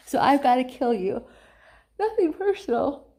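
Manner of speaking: loud, high taunting god of war; mocking threat. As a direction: toss off the death threat like a joke. Ares threatens Percy.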